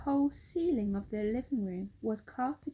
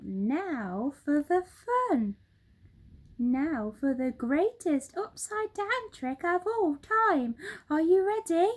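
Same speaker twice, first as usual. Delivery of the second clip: gleeful announcement; 7 semitones higher, 6 dB louder than usual. Acted. Mugglewump revealing the upside-down trick, gleeful. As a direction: savour the big trick.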